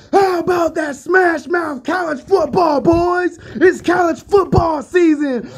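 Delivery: over-the-top high, loud hype shout; feeling and emotion. Shown as a demonstration. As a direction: belt it out like a fired-up football announcer. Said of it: "say that again with some feeling and emotion".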